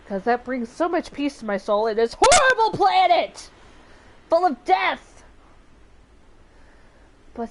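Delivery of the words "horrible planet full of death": loud, high cry of exaggerated despair; sudden high shout; mock outburst. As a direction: blurt it out loudly, hamming up the doom. Mock outburst amid praise of voice.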